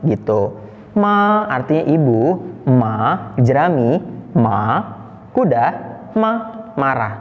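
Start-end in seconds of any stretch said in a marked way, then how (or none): 1.0-1.4 "ma" high, long and flat; steady first-tone "ma". Shown as a demonstration. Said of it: "Nada satu datar". 2.7-3.3 lifted second-tone "ma". Shown as a demonstration. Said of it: "nada dua dari datar ke atas". 4.4-4.8 "ma" dipping down, then up; third-tone "ma", long dip. Shown as a demonstration. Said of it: "Jadi diayunkan". 6.2-6.3 "ma" short, falling from high; quick fourth-tone "ma". Shown as a demonstration. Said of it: "nadanya turun ke bawah".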